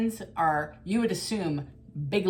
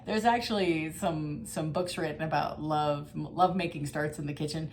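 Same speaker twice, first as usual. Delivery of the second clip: normal talking voice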